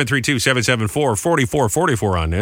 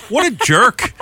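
much higher, scornful inner scoff; indignant thought; 11 semitones higher, much slower than usual. Retold or imitated. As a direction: sneer it from up high. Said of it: "And I remember thinking".

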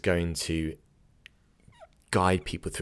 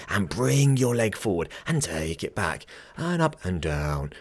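lightly exaggerated imitation of a skilful class teacher; Adam Husler. Podcaster mimics a yoga teacher cueing class, expressive guiding voice. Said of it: "how skillfully he uses his voice".

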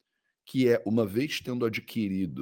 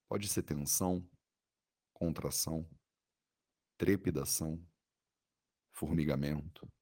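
soothing guided meditation. Acted. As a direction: guide the body scan gently, with long pauses.

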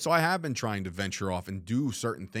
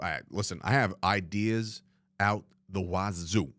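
usual speaking voice

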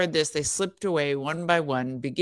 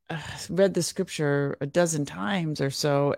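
normal talking voice

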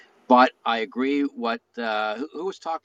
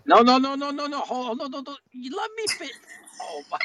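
excited protest; 12 semitones higher than usual. Real, not acted. Cut off, protesting over others.

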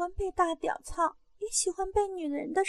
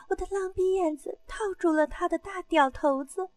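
ordinary delivery

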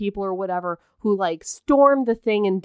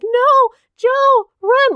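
a hammed-up panicked shout, much higher and louder; mock frantic yell; 13 semitones higher, 7 dB louder, much slower than usual. The wife yelling at Joe over the intercom, a mock frantic shout. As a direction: scream it like a hysterical wife over the radio. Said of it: "over the intercom being like".

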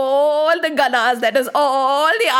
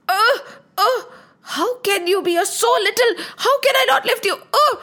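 straining high grunts; 8 semitones higher than usual. Acted. Nandi struggling to lift.

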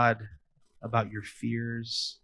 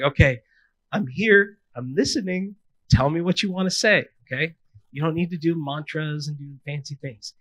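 patient father; 5 semitones higher, 7 dB louder than usual. Pretend. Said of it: "He's like".

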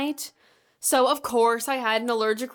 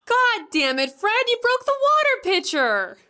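angry shout; 10 semitones higher than usual. Acted. Pitcher broken, yelling at Fred.

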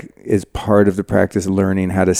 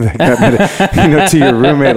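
laughing; 5 semitones higher than usual. Real, not acted. Podcast host laughing while imagining retreat chatter. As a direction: giggle while picturing the roommate chat.

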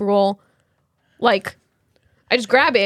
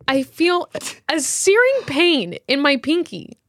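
ordinary talk

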